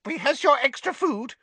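high, hurried house elf; squeaky elf. A narrator's reading. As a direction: squeak it out brisk. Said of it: "squeaked an elf".

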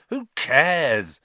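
loud, high, impatient dismissal. A narrator's reading. Boy eager to try the broom, impatient. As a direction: brush it off loudly and impatiently. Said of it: "said Ron impatiently".

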